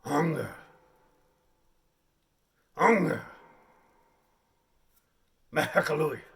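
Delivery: booming primitive grunts of hunger; loud caveman. Acted. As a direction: bellow like a starving chieftain. Caveman audition performance.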